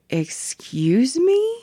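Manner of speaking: a slow, stunned "excuse me?"; incredulous. Retold or imitated. Narrator reacts to slur request.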